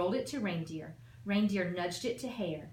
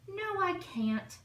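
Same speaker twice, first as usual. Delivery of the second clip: put-on small girl's voice; 11 semitones higher, slower than usual. Little Nell refuses shyly.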